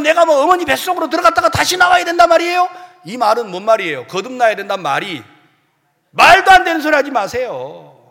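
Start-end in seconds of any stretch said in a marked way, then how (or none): 0.0-2.7 high, fast, disbelieving protest; incredulous Nicodemus. Retold or imitated. Nicodemus protesting to Jesus, fast incredulous objection. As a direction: rattle it off in outraged disbelief.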